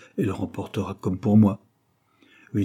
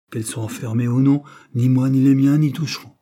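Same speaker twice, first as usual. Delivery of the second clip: louder, higher, emphatic promise; firm assurance; 5 semitones higher, 7 dB louder than usual. A narrator's reading. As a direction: assert it with wounded firmness. Landlady defends her household's honesty.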